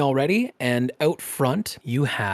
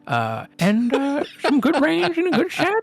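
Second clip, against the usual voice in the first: high, loud gushing gnome; gnome salesman; 10 semitones higher, 3 dB louder than usual. Acted. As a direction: sell it like an excited little gnome. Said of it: "he's like".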